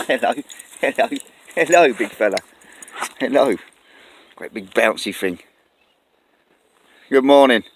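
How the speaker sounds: loud, delighted hello to a passing dog; greeting a dog. Real, not acted. Greeting a bouncy dog on his walk, loud and delighted. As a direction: cheerfully call out to the big dog. Fussing over a friendly dog.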